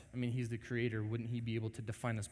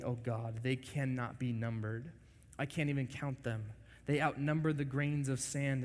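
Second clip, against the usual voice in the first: scripture reading; 4 semitones higher than usual. Acted. Reading psalm.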